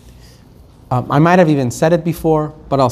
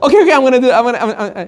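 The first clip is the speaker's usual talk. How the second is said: high, stammering scramble to fix it; frantic bargaining; 7 semitones higher, much faster than usual. Acted. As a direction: trip over yourself promising to act. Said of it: "instantly you think to yourself".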